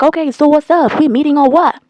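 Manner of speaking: mock-insistent questioning; pushy retold demand. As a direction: press for an answer, imitating him. Retelling his pushy demand.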